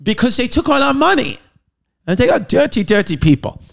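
a parody demagogue whipping up a crowd; mock Hitler rant. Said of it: "rally the German people and be like".

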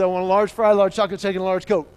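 loud, eager list; acted kid piling on the food; excited kid ordering. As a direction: belt out each item with relish.

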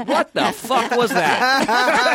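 slow, high-pitched imitated disbelief; mock bafflement. Everyone reacting to his speech, slow mock bafflement. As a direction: draw it out high, as a stunned onlooker. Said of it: "And everyone's like".

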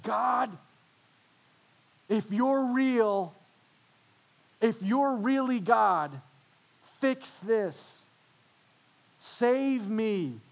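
high, loud pleading demands, some lines slow; desperate yell at God. Retold or imitated. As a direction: beg and demand at once. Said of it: "when you yelled at God like that".

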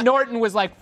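usual speaking voice

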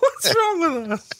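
high laughing "What's wrong with us?"; laughing question. Real, not acted. Host laughing after reading rude texts, high laughing. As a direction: ask it while cracking up.

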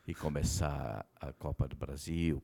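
low hushed secret-sharing voice; leaning in to whisper. Real, not acted. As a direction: drop the voice like telling gossip. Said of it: "quando eu vou falar baixinho".

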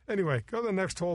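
ordinary talk

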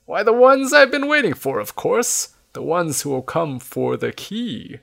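put-on high-pitched impatient old man. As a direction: explain it as if it should be obvious. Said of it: "says the man impatiently".

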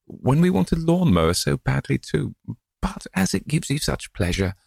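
a fond wife's mild reproach, voiced high; gentle old wife. Old wife to husband about the cheque, gentle reproach.

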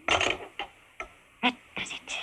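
slow, breathless whisper through effort; strained whisper. Acted. Prisoner forcing the cage lock, slow strained whisper. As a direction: squeeze it out while straining.

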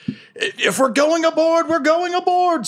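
very high, slow rallying cry; strained high-pitched captain's call; Captain Ayu. Acted. Captain rallying crew to board, high urgent cry. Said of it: "Captain Ayu is like".

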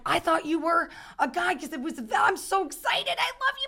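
high, rushing fangirl squeal; excited fan gush. Retold or imitated. Fan gushing to Dana at the table, squealing high and excited. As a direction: gush like a starstruck fan meeting her idol.

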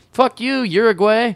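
hammed-up patriotic shout; mock shout. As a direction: yell it like a rowdy fan. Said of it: "when you say, like".

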